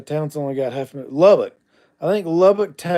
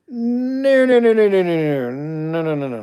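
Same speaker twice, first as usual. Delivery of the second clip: emphatic no's; 6 semitones higher, 4 dB louder than usual. Real, not acted. Emphatic refusal of Tennessee.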